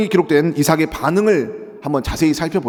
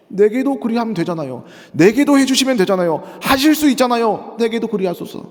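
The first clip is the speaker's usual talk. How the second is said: pleading son; 6 semitones higher than usual. Acted. Pastor voices Esau begging.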